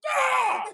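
sudden loud high "Ah!" to startle; jump-scare shout. Real, not acted. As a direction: leap out and yell to scare her. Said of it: "I jump out in front of her".

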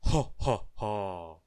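loud thunderous laughter; booming laugh. Acted. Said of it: "laughed a laugh as loud as thunder".

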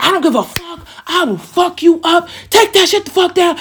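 high-pitched mimicry of a raging man; imitated angry threats. Man trashing her room, imitated angry threats. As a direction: act out his threats without full volume.

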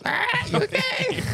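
very high, slow squawked taunt; mock parrot squawk. Friend teases the storyteller with the bird, mock squawking. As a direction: screech it like a teasing parrot.

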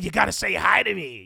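lightly mocking Biden. Host quotes Biden to his viewers, lightly mocking. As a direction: quote him with a light sneer. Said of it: "He said".

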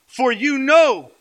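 high, loud, slow declaiming; ringing preacher's quotation; emphatic scripture reading. Real, not acted. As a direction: proclaim the verse, stretching the last word.

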